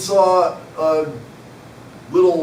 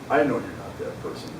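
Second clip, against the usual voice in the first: disappointed grandma; 8 semitones lower, 6 dB quieter than usual. Retold or imitated. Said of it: "She was disappointed".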